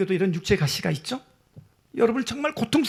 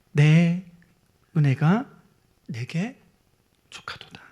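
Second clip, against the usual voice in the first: fading to whisper; 4 semitones lower, slower than usual. Acted. Preacher quoting God to the congregation, fading to a whisper. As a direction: let God's word trail into a hush.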